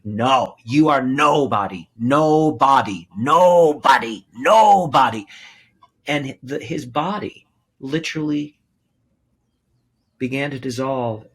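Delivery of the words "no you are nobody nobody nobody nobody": building from loud to a near shout; retold scream. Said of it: "and I had screamed".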